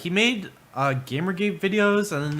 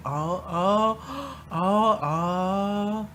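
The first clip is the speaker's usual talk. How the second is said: touched aww; 5 semitones higher, much slower than usual. Real, not acted. Touched by child's apology.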